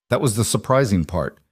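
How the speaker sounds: plain reading voice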